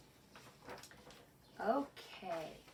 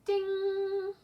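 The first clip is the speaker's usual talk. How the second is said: high loud sung "ding" bell; 12 semitones higher, 8 dB louder than usual. Shown as a demonstration. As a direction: ring out like a bell. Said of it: "ding if it rhymes".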